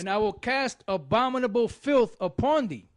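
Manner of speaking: measured, solemn Bible reading; steady solemn reading voice; scripture reading. Acted. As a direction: read it like the King James from the pulpit. Reads scripture aloud.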